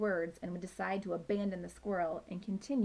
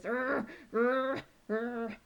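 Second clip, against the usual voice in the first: growling; 4 semitones higher, much slower than usual. Acted. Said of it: "growling at the uppermost branches".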